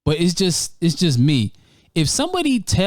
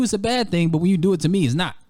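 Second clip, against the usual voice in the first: ordinary delivery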